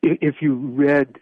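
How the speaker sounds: choking up. Real, not acted. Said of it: "God, I'm choking up".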